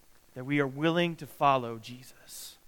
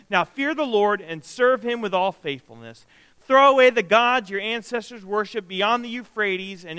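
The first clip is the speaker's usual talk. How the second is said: raised, declaiming scripture read; 3 semitones higher, 4 dB louder than usual. Acted. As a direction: command the people to serve God. Reads Joshua's command.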